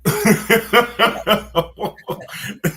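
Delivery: high burst of laughter; real laugh at his own impression; laughing after the uncle voice. Real, not acted.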